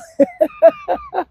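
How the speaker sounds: a sudden shrieking laugh; a squealing laugh at the story's end; high burst of laughter. Real, not acted. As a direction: laugh out high after the punchline.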